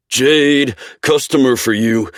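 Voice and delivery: chamando alto para os fundos; calling out. A narrator's reading. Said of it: "he calls".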